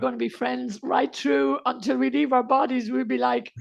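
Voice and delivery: usual speaking voice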